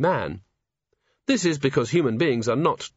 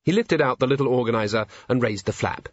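plain reading voice

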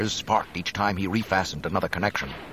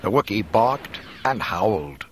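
ordinary delivery

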